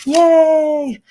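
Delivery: high, loud little cheer; real happy yay; excited yay. Real, not acted. Cheering at stickers.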